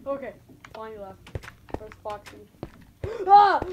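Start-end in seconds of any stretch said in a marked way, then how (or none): 3.3-3.6 very loud, high shriek; frightened scream. Real, not acted. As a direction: shriek at the sudden sight. Monster scare in game.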